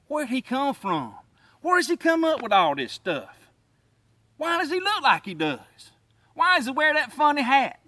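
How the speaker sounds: mimicking a baffled viewer's questions; puzzled viewer.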